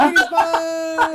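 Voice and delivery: high booming shout of the theme; hype announcer shout. Acted. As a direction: sell it like a trailer voice. Hyping the movie theme.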